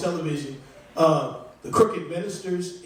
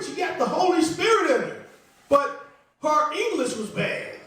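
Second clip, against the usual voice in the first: mock preacher; 9 semitones higher than usual. Crooked pastor retelling his sermon.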